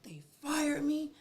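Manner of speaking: slow, high whisper to God; whispered plea. Retold or imitated. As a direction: breathe it out as a stunned, hushed prayer. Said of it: "I'm like".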